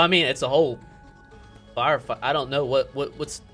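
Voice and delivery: usual speaking voice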